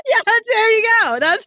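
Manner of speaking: laughing through the words; can barely talk for laughing. Real, not acted. Laughing at mama joke.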